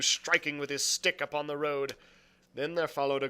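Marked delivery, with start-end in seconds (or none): none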